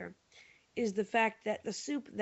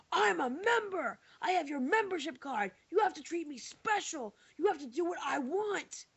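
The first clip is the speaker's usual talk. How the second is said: mock angry customer yell; 8 semitones higher than usual. Customer pounding the counter at the cashier, mock indignant high shout.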